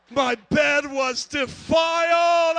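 slow, high, loud wail; horrified outburst. Retold or imitated. Preacher confessing he soiled his bed, horrified drawn-out shout. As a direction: shout it out, stretching DEFILED in horror.

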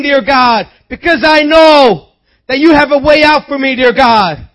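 loud, high-pitched crying out to God, building to a shout; impassioned prayer. Real, not acted. Believer begging God to show the way, impassioned shouted prayer. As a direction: plead with God at full preaching force. Cries out a prayer mid-sermon.

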